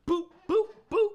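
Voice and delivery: high loud boop punch noises; punch sound effects. Retold or imitated. Acting out body shots in sparring, high punchy boops. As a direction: pop out each boop like a jab landing. Getting hit in corner.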